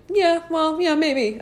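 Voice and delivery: high-pitched mock agreement; sarcastic. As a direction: drip sarcasm at the clueless teacher. Sarcastic reply to the teacher.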